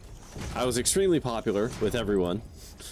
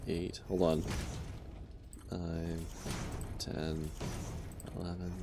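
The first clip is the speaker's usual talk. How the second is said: soft, spaced-out private count; tally quietly while concentrating; counting to self; 3 semitones lower, 11 dB quieter than usual. Real, not acted. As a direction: numbers murmured to yourself. Counting blocks to himself while building.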